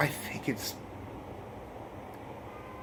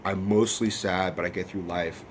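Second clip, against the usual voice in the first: sad mother voice; 5 semitones lower, 9 dB quieter than usual. Retold or imitated. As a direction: let each word sink, quietly defeated. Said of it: "the mom is just like".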